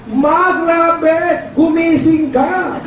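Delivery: high, excited call to his wife, slowing at the end; excited wake-up call. Retold or imitated. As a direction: wake your wife with big news. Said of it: "ginising ko asawa ko".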